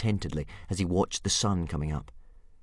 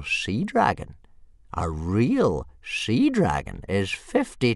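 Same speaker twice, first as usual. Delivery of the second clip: put-on slow, grand old man, higher and louder; old soothsayer; 6 semitones higher, 6 dB louder, slower than usual. Old Wrinkly to Hiccup, slow and grand. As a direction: paint the sea dragon's vastness.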